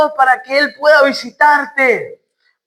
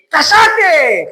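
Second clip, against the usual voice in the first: crowd's rebuke; 4 semitones higher than usual. Acted. Crowd silencing the blind man, scolding shout. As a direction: cut across him with a raised voice. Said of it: "la gente decía".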